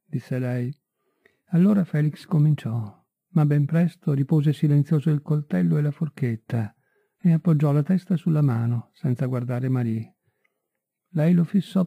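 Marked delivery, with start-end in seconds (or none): none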